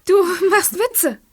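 high excited laughing disbelief. Acted. Overjoyed at the good grade.

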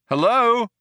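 high, questioning call. A narrator's reading. Ford checks whether the cops are there, called out. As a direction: call out to see who is there. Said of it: "called out Ford".

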